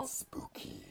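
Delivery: whispered spooky voice. Pretend. Co-host tries the spooky voice, whispered and eerie. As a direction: breathe it like a ghost. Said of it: "if you wanted to do a spooky voice".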